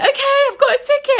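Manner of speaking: very high, loud retold cry; frantic wail. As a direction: announce it in a mock-hysterical squeal. Frantic return to the desk.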